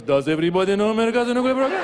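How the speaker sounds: fast, innocent question thrown back; evasive echo. Retold or imitated. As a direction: echo the statement as a coy question, quickly. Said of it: "He goes".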